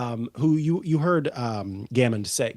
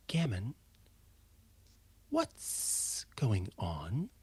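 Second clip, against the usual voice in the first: slow soft hush to her son; barely voiced, slow and gentle; quiet whisper; 2 semitones lower, 10 dB quieter, slower than usual. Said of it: "she looks down and she says".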